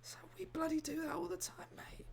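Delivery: hushed, voiceless whisper; whispered aside. Retold or imitated. British comic confiding to a mate, whispered. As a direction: lean in and whisper it like a secret.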